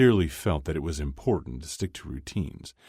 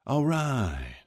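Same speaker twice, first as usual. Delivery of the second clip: higher lilting all right, teasing; sing-song; 9 semitones higher than usual. A narrator's reading. Dad backing off from Kip, sing-song and teasing. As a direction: let it lilt up and down, unconvinced. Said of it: "in that sing-song tone".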